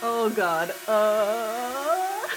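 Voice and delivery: high, loud shocked cries. Real, not acted. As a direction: react in shock to the crash. Reacting to drone crash.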